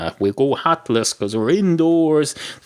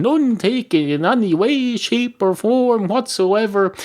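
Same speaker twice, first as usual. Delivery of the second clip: emphatic high reply; 10 semitones higher than usual. Acted. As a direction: insist grandly, rising high on none. Dog replies emphatically.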